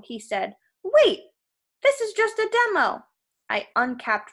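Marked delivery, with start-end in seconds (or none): 0.8-3.1 very high, loud pleading; panicked protest. Acted. Krusty tied down before Percy, panicked pleading. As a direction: beg loudly for a halt. Trapped giant pleads for life.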